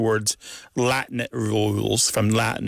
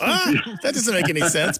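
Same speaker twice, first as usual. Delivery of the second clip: baffled people; 7 semitones higher than usual. Retold or imitated. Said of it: "which people are like".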